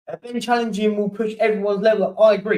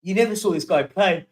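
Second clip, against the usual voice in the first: ordinary delivery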